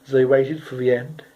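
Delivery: usual speaking voice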